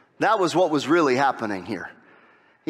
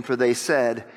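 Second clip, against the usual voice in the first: ordinary talk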